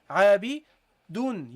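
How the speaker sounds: عابدون with a clear final noon; noon pronounced. Shown as a demonstration. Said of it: "you hear a noon".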